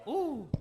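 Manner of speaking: high swooping "ooh" of delight. Acted. Diner greeting something delicious, delighted ooh. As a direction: coo at tasty food showing up.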